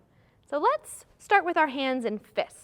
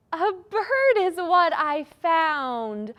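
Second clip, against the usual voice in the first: high, loud, slow announcement; playful rhyme punchline; excited reveal; 5 semitones higher, 5 dB louder, much slower than usual. Pretend. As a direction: stretch out the answer with delight.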